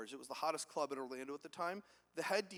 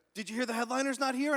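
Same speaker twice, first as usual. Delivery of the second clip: raised, louder question with rising end; loud stranger's question; 7 semitones higher, 7 dB louder than usual. Retold or imitated. Bathroom stranger repeating the headliner news, calling out. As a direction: call out the gossip, pitched up.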